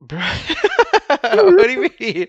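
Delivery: high, loud, slow disbelief; incredulous. Pretend. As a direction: squeal it in outrage. Mock outraged protest, bruh.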